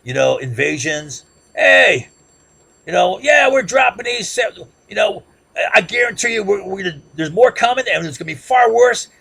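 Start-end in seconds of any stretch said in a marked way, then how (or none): 1.5-9.1 high, loud, boastful shouting; bragging. Retold or imitated. Colonel Key bragging loudly about coming attacks. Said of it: "openly blabbed and bragged".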